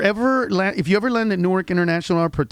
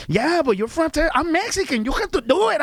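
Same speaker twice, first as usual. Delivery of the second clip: high mock Mexican boss; 8 semitones higher than usual. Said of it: "quarrel between delivery guys".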